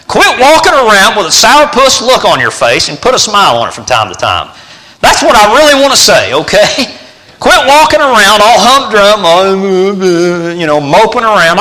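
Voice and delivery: high, loud shouted exhortation; fervent rebuke from the pulpit; preaching. Real, not acted. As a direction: drive it home at full force. Preaching at full force.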